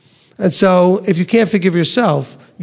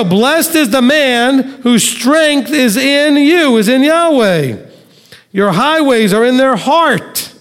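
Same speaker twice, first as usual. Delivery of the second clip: raised, unhurried reading of the psalm; scripture reading; 6 semitones higher, slower than usual. Acted. Teacher reading Psalm eighty-four aloud to the class, reverent and slightly raised. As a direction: read the verses out to the class with reverence. Reads verse five.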